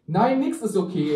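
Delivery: high, loud acted refusal; upset protest. A narrator's reading. Emma rejects her date's comforting, upset protest. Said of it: "Ich versuchte sie zu beruhigen".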